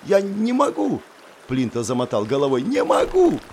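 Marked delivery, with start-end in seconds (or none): 0.0-1.0 high scared cry. Acted. Terrified student refusing to jump. 2.6-3.4 very high panicked shriek. Acted. Terrified student repeating refusal.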